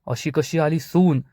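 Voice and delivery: plain reading voice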